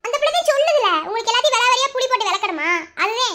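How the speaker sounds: high, loud, fast scolding; angry outburst. Acted. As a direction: snap back at him in exasperation. Woman snaps at the husband. (கோபமான வெடிப்பு)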